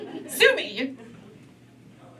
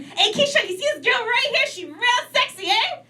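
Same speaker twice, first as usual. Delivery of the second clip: put-on pitched-up, leery drunken uncle; 13 semitones higher than usual. Drunk uncle in the corner calling to Keisha, high and leery.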